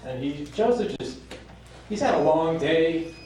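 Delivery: ordinary talk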